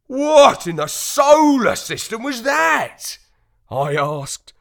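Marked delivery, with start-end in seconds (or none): 0.1-3.2 high, loud startled yelp; shocked, loud and high; startled cry. Acted. Dog startled by streak of light.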